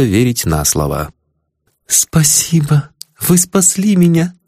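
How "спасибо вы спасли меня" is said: cooing. A narrator's reading. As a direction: coo it like a little bird. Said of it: "проворковала голосом".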